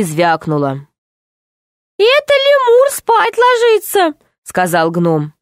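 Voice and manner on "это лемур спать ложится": high, earnest little gnome; tiny gnome voice. Acted. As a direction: explain it like a small nervous gnome. (тоненький голос гнома)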